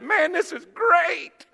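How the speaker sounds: high, slow, drawn-out "great"; excited exclamation. Retold or imitated. Preacher reliving his drive home, excited exclamation. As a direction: cry it out, overjoyed. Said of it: "I'm so excited".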